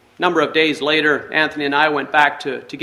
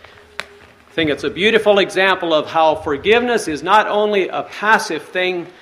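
usual speaking voice

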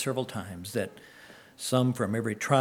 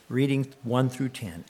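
ordinary talk

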